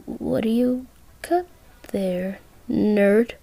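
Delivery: plain reading voice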